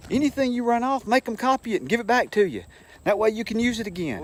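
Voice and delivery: high, fast mock teacher voice giving orders; supervising teacher. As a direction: rattle off the rule in a high imitating voice. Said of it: "He said".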